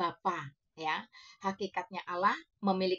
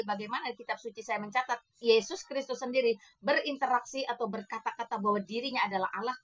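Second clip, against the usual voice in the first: ordinary talk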